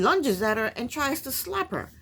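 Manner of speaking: ordinary talk, nothing marked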